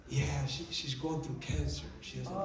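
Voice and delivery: soft, low voice of a worried husband, retold; subdued confiding. Husband explaining his wife's cancer to a stranger, subdued. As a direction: confide it quietly, pitched low.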